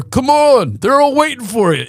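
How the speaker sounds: loud, high call through a door. Retold or imitated. Dad knocks at seven in the morning, impatient holler. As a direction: imitate dad hollering to hurry up.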